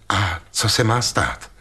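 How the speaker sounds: plain reading voice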